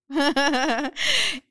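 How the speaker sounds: high giggling laugh. Real, not acted. Amusement après la réponse. (rire aigu)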